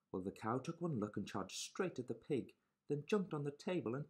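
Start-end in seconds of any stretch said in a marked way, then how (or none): none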